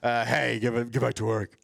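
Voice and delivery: lightly put-on authority voice; a mock order in a slightly deepened voice; deepened boss voice. Joking about being taken seriously at work, lightly deepened boss voice. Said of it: "I need to deepen my voice".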